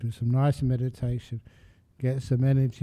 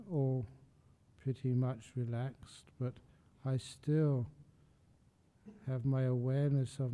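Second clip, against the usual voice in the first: soft, unhurried body scan with long pauses; guided meditation; 9 dB quieter than usual. Real, not acted. Meditation teacher talking listeners up from feet to legs, soft guided meditation. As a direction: lead them gently through the body, growing quieter and leaving space between phrases.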